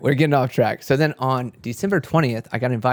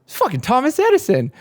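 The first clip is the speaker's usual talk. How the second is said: much higher, excited gasp of recognition; stunned recognition; 13 semitones higher, 4 dB louder than usual. Retold or imitated. Recognizing the man at the party, stunned and excited. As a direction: squeal it like you've spotted a celebrity. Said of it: "and I'm like".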